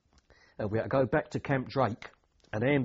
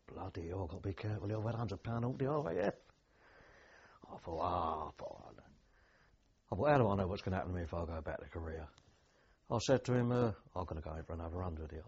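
much softer, muttered inner thoughts; thinking to himself; 5 semitones lower, 6 dB quieter, faster than usual. Retold or imitated. Soldier quietly scheming how much pay to claim, muttered aside.

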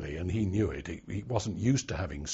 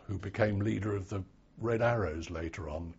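ordinary talk

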